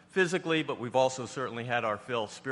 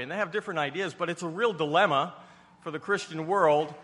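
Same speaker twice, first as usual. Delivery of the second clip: higher and louder, building preacher's cadence; preaching at full force; 6 semitones higher, 5 dB louder than usual. Acted. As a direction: drive the point home from the pulpit.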